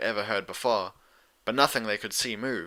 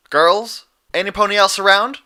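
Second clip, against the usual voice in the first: loud, higher call into the dark; yell; 10 semitones higher, 10 dB louder than usual. Acted. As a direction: shout out for the others. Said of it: "Applejack yelled".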